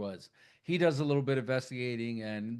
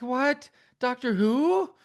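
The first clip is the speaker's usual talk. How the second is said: much higher, louder, rising disbelief; mock confused shout; 12 semitones higher, 9 dB louder, slower than usual. Mother baffled on the phone by the caller. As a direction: blurt it out, baffled.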